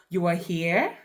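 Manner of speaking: "here" gliding up at the end; rising intonation. Shown as a demonstration. Teacher showing intonation changes function, "here" rising. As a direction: lift "here" like a question. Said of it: "intonation can change the function".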